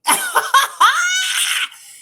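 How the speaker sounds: a big high burst of laughter; loud laugh. Real, not acted. Vlogger laughing out loud at the dad's message. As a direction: crack up out loud.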